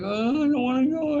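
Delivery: high, whiny imitation of a little girl; dad voicing his crying daughter, high and whiny; imitating a crying child. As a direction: mimic the daughter wailing that she won't go. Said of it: "she's crying 'cause she didn't wanna go".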